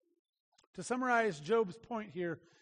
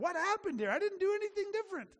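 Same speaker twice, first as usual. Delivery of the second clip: higher, fast exasperated plea; bewildered protest, rushing; frustrated Job, pitched up and hurried; 9 semitones higher, faster than usual. Acted. Job's frustrated voice, quoted.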